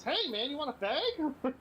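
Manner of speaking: high, slow, teasing offer; mock friendly. Offering bullies a cigarette to wind them up, mock friendly. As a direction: stretch out a high, needling offer. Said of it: "Just to really fuck with them".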